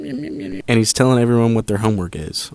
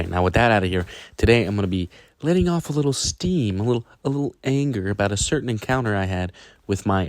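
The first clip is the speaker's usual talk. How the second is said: ordinary talk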